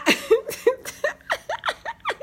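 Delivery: a burst of high laughter; helpless giggle. Real, not acted. Laughing at her own story.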